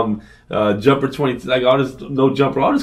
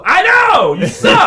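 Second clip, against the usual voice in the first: high, loud exasperated comeback; re-enacted shout, pitched way up; retold yelling; 5 dB louder, much slower than usual. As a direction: shout it back at the kid. Retold yelling back at kid.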